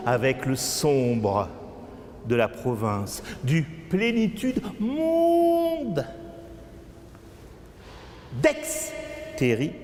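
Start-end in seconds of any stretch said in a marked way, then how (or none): 3.4-6.1 high, slow drawn-out cry; theatrical wailing chant, very high; wailed refrain. Acted. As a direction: soar up and hold the last word. Last refrain cried out in poem.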